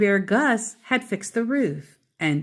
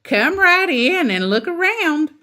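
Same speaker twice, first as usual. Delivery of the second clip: big, booming and cheerful, much higher; hearty shout of welcome; loud jolly voice; 10 semitones higher, 6 dB louder than usual. A narrator's reading. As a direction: welcome them in loudly and jolly. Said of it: "in a loud, jolly voice".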